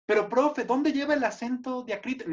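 questioning pupil. Acted. Pupil asking teacher about accents, high questioning voice. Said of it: "dando clases de español".

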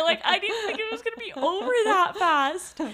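half laughing, half crying as it hits her; laughing through tears. Real, not acted. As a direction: voice cracking high between laugh and cry. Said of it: "my emotional little moment".

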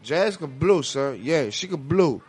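ordinary talk, nothing marked

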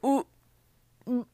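gagging retch noises; dry heaving. Real, not acted. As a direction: gag as if about to retch. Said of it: "I'm making gagging noises".

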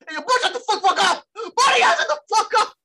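high, loud yell; shout them down. Retold or imitated. Friend yelling at the fighters to shut up, loud high shout. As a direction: shut everybody up at full voice.

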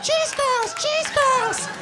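very high falsetto chant; hammed-up manic cry; crazed snack craving. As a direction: squeal it twice like a wired maniac. Wired brother, likened to Nicholson.

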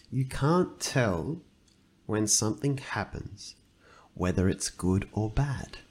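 very slow, with pauses between phrases; slow, deliberate repeat. Real, not acted. Podcaster restating the fable's moral to his co-host, slow and deliberate. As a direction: spell it out slowly, phrase by phrase. Slowly repeats the quoted line for weight.

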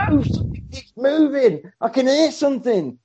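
high-pitched acted panic; hammed-up fearful protest; mock alarm. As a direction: play the alarmed boyfriend. Acts boyfriend alarmed by demon.

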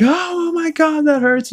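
high-pitched yelp of pain; mock agony, voice leaping way up; pained cry. Death hurts himself trying to get up, pained yelp.

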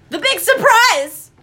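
loud, high, slow call; excited shout. Real, not acted. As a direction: belt out the guess. Calling out a guessed answer.

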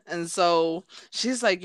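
ordinary talk

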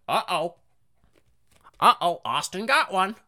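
high-pitched parrot voice; tattletale parrot. Acted. Classroom parrot telling on Austin, high and parroty. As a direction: squawk it like a parrot telling on someone. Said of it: "the parrot would go".

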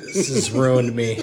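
slow words squeezed out through laughter; can barely get it out for laughing; helpless laughter. Real, not acted. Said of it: "my face hurts from laughing".

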